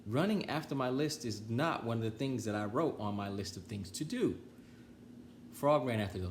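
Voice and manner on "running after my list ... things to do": ordinary delivery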